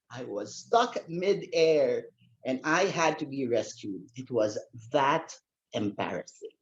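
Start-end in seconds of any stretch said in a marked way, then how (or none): none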